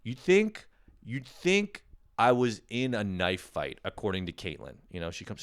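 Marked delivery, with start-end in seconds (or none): none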